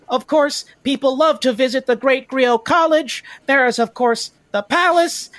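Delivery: high, emphatic tour-guide patter; eager tour guide. Acted. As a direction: present the sights with keen, high-pitched ceremony. Guide lists sights to visitors.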